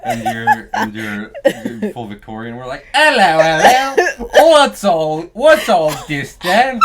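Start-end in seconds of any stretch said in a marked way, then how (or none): 2.9-6.8 loud, high, slow fake English; hammed-up English bobby-style accent, drawn out; purposely bad English accent. Playing someone from World War Two England, hammy English accent. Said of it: "that was a purposely bad accent".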